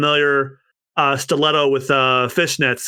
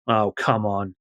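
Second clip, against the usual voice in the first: ordinary delivery, nothing marked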